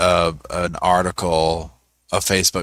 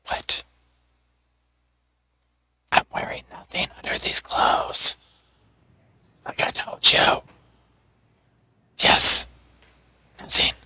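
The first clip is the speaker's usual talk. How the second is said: breathy whisper, mock-secretive; whispered quote. Retelling the woman's words in court, whispered. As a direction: hiss it like a naughty secret. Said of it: "She was like".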